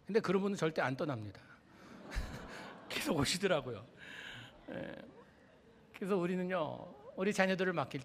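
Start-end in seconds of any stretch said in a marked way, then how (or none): 2.0-2.8 brief unvoiced laugh; short chuckle. Real, not acted. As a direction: chuckle to yourself after the punchline. Preacher chuckles after his remark. (짧은 웃음)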